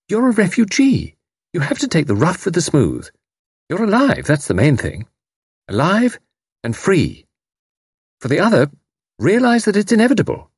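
high, brisk no-nonsense lady; crisp and compelling. A narrator's reading. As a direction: set him straight crisply. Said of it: "Tuppence's voice was crisp and compelling".

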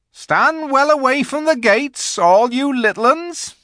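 high, loud warning call; booming rustic giant. A narrator's reading. Giant warns the little ones off the gates, hollering. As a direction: holler down to the small folk. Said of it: "said the giant Rumblebuffin".

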